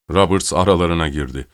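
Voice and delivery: usual speaking voice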